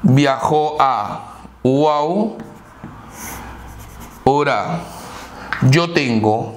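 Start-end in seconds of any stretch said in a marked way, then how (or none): 0.0-4.8 slow again, falling at the end; drawn-out repeat, closing the example with a fall. Shown as a demonstration. Teacher repeats Viajó a Huaura slowly to close the example. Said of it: "second slow model take".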